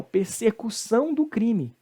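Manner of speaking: ordinary talk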